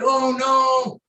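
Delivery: loud, high, drawn-out wail of dismay; retold scream. Dad reliving the Bears' loss, screaming in dismay. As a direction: cry out like a fan watching the game slip away. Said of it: "And I'm screaming".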